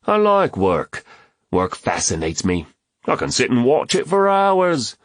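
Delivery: high, theatrical comic declaration; Reuben joking. A narrator's reading. Joker defends himself to friends, high and theatrical. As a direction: sweep the pitch up high for the joke.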